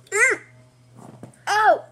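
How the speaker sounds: pained yelps with sour candy in mouth; eating sour candy. Real, not acted.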